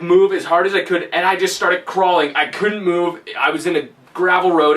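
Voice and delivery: ordinary talk, nothing marked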